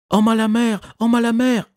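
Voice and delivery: very high, loud alarm cry; shouting. A narrator's reading. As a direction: raise the alarm at full voice. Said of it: "ai-je crié".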